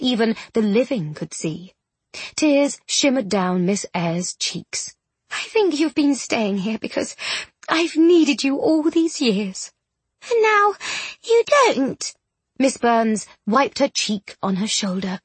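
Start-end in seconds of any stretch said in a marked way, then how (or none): 5.3-12.1 high, strained voice climbing higher to the end; tearful hurt. A narrator's reading. Ghost girl fearing she is no longer needed, tearful. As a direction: start upset and let the pitch rise toward breaking.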